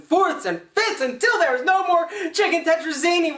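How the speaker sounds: high-pitched, building mock frenzy; hammed-up runaway excitement; worked-up rant. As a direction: get carried away, pitch jumping way up. Worked-up climax of mock fantasy.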